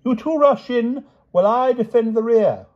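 very high, loud, gallant orders; crying out. A narrator's reading. King sends companions inside, crying out. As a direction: call out battle orders excitedly. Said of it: "cried the king".

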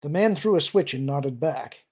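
plain reading voice

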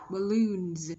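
"Balloons" ending in a buzzed z; loud, careful "Balloons" with voiced z at the end; final Z sounded. Shown as a demonstration. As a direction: finish "Balloons" on z, not s. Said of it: "Z ending sound".

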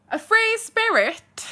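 high, drawn-out, loud disbelief; loud incredulous echo. Real, not acted. Exclaims a comment after reading it.

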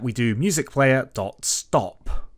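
ordinary talk

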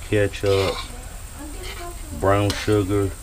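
ordinary talk, nothing marked

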